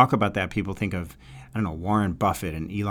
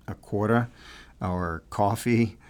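New York accent. Shown as a demonstration. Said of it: "sound more like a New Yorker, like when I say".

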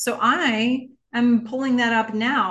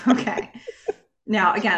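ordinary talk

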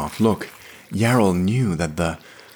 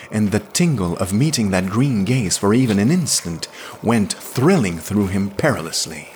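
plain reading voice

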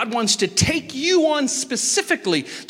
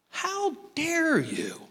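slow, high, soft mock outrage; a hammed-up wounded pride; indignant inner voice; 8 semitones higher, 6 dB quieter, much slower than usual. His inner voice answering his wife, slow mock indignation. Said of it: "a side of me going".

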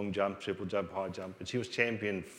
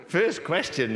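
amused, slowed and pitched up through a laugh; laughing at the first question; 5 semitones higher, 5 dB louder, slower than usual. Real, not acted. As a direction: chuckle it out, slow.

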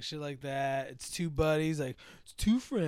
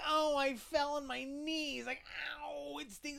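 high, slow whiny complaint; mock whiny victim; 10 semitones higher, 6 dB quieter, slower than usual. Mocking the man fallen on the escalator, whiny high voice. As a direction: whimper it like a wimpy victim.

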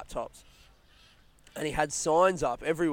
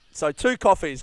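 cheeky upsell; 2 semitones higher, 9 dB louder than usual. Retold or imitated.

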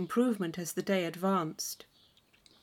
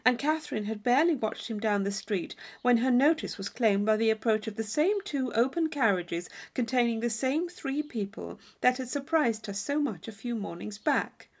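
ordinary delivery, nothing marked

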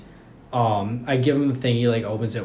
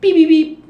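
high, loud electronic beeps; thermometer beeps; 14 dB louder than usual. Retold or imitated. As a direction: imitate a beeping thermometer loudly, high up.

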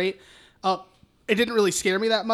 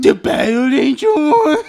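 slow, loud, high mock voice; mocking imitation; 5 semitones higher, 9 dB louder than usual. Podcaster mocking co-host's Battle Angel guess, mocking imitation. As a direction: parrot the wrong guess to tease.

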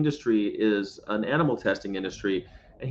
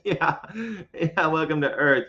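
high, laughing "Oh, yeah, welcome to Earth"; laughing along; 5 semitones higher, 3 dB louder than usual. Retold or imitated. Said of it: "I went".

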